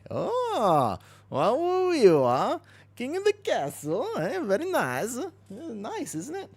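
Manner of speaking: put-on high-pitched Borat-style foreign accent. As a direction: exclaim in a slow, squeaky Borat accent. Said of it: "and that's Borat".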